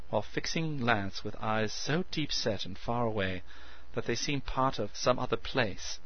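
ordinary delivery, nothing marked

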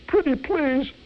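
very high, hammed-up begging; pleading cry. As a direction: squeal it like a panicked brat begging. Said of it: "with cries of".

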